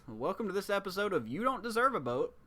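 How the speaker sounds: high, bright mock show intro; TV announcer. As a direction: welcome viewers like a TV host.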